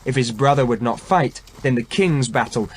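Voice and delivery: ordinary delivery, nothing marked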